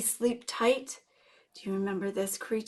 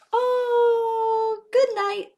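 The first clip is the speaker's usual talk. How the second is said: much higher and louder, sing-song; high sweet goodnight; 12 semitones higher, 6 dB louder, much slower than usual. Acted. Saying goodnight to the little axolotl, high and sweet. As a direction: coo it up high.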